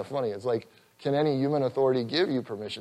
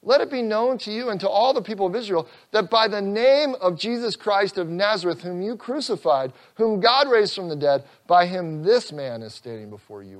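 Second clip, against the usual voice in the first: high, loud preacher's voice, slowed; fervent preaching; 6 semitones higher, 3 dB louder than usual. Acted. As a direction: preach it out with full force, rising. Quoting Peter's sermon.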